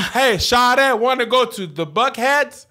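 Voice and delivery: high, loud mock Atlanta accent; Atlanta slang. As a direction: ham up the Atlanta cousin calling out shawty. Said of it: "Trying to learn how to say "shawty".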